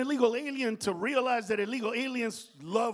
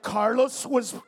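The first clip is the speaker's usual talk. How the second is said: slow, loud, high hammed-up complaint; over-the-top mock hurt; mock wounded shout; 6 semitones higher, 9 dB louder, much slower than usual. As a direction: wail it like a wounded diva. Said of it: "be like".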